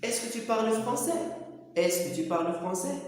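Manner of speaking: slow model question rising on "français"; question intonation. Shown as a demonstration. Teacher shows learners how to ask, slowly rising on "français". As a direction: spell out the question slowly, lifting "français" at the end. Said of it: "تزيدوا على الاستفهام".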